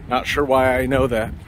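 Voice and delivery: normal talking voice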